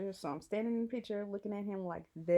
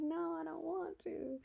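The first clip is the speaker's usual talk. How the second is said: reluctant refusal; 10 semitones higher, 4 dB quieter than usual. Retold or imitated. Reluctant reply to friend.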